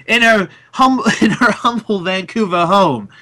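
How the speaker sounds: laughing through a posh British voice; grand British host, breaking into laughs; British announcer accent. Retold or imitated. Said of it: "greatest British announcer".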